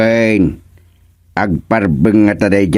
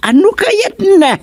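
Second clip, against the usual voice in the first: sharp, much higher incredulous question; surprised. Acted. Woman asked why her story is late, surprised high question. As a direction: shoot the pitch up in disbelief.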